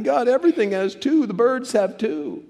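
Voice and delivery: high-pitched plaintive Adam; complaining Adam. Acted. Adam comes back to God without a mate, plaintive. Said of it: "Adam comes back".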